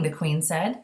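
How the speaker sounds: usual speaking voice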